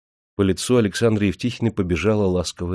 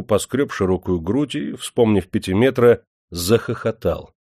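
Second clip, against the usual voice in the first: ordinary delivery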